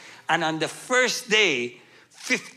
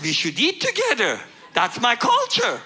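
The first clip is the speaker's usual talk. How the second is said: high-pitched comic protest; playfully put-on high voice; mock eager reply; 8 semitones higher, 3 dB louder than usual. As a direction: pitch it up as an over-eager plea. Joking answer to the interrogator.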